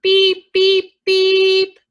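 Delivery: high, loud repeated beeps; three high horn blasts; urgent horn beeps. A narrator's reading. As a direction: beep for help like a little truck. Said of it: "cried the little blue truck".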